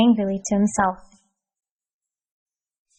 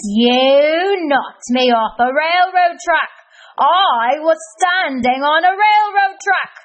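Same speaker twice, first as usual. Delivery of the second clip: loud, slow, indignant sputter; 6 semitones higher, 5 dB louder, slower than usual. Acted. Kareem realising he stood on the track, loud sputtering. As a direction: splutter in shock. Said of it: "sputtered Cranky Kareem".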